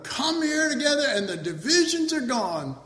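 hammed-up proclamation, starting high; mock conference speaker. Preacher parodies a conference boast, mock speaker. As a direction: proclaim it like a gushing host. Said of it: "That's the high point of some conferences".

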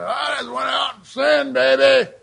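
loud, drawn-out boast; hammed-up unrepentant bragging; acted brazen confession. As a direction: holler it like a shameless sinner. Voices the unrepentant kind of sinner.

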